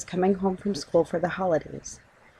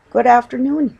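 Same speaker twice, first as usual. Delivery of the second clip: small clear voice; 6 semitones higher, 8 dB louder than usual. Acted. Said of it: "he said in a small, clear voice".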